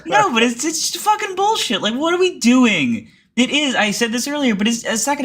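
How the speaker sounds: fed up, throwing the words out high; exasperated outburst. Real, not acted. Exasperated rant about the senator.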